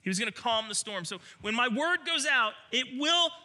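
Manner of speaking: high, loud, forceful preaching. Real, not acted. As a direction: proclaim it with rising force.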